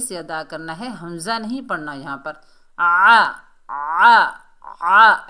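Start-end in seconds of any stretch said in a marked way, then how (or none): 2.8-5.3 आ as a loud ain squeezed mid-throat. Shown as a demonstration. Teacher demonstrates the letter ain, forceful throaty आ. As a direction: push each आ from the middle of the throat. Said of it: "ऐसे करके".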